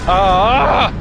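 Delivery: loud high yell of frustration; real gamer rage yell; frustrated yell. Real, not acted. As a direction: let out a sharp angry ah. Dies in game, outburst.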